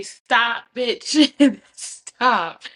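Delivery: mock stop. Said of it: "when I say".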